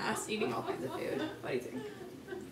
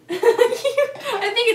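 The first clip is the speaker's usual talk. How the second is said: laughing; 11 semitones higher, 14 dB louder than usual. Real, not acted. Laughing at the result.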